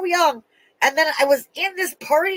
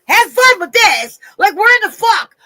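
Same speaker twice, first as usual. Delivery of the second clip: real angry yell, higher and louder; angry scream; 6 semitones higher, 4 dB louder than usual. Real, not acted. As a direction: scream out your fury at it all. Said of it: "I didn't mean to scream at you".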